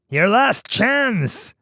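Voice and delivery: high, slow shouted warning; a slow, ringing last warning; cried out. Acted. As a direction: call it out as a final threat. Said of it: "he cried".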